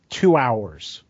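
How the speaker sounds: ordinary delivery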